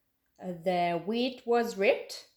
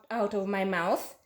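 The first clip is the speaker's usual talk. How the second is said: ordinary delivery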